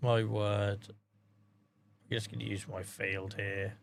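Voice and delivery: low, quiet deflated murmur. Real, not acted. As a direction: say it softly to yourself, low and slow. Deflated mumbling over broken game.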